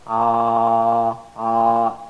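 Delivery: ah held long, two fathas' length; stretched alif. Shown as a demonstration. Teacher demonstrating the long alif sound. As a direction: stretch the ah out evenly. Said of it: "there's a stretched voice of fatha".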